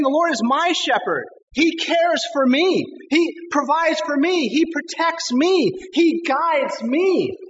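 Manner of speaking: high, slow, assured declaration; confident. Acted. Preacher voicing David's trust to the congregation, slow and assured. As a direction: proclaim it with total trust, each clause slowly. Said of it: "hear the confidence in David's voice".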